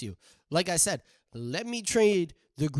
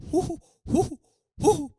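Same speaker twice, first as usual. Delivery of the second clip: high excited hoo hoo whoops; high excited hooting; 12 semitones higher, 7 dB louder than usual. Acted. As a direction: whoop like you're pumped up. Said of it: "you know, just".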